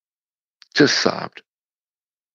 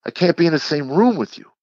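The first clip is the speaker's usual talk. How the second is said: wife's fed-up plea, pitched much higher; retold exasperation; 9 semitones higher than usual. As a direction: imitate an exasperated wife at her limit, voice lifted high.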